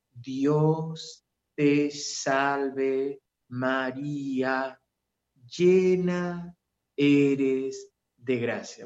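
Dios te salve drawn out extremely slowly, every syllable stretched; overly dragged-out prayer; slow motion prayer. Shown as a demonstration. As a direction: exaggerate the slowness to mock it. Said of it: "parece cámara lenta".

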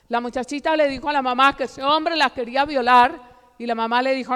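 ordinary talk

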